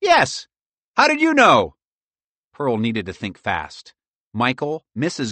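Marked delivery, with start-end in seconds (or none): 0.0-1.8 high, raised squirrel voice; high-pitched surprised shout; called-out reply. A narrator's reading. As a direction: call it back down, high. Bob shouting down reply to Pearl.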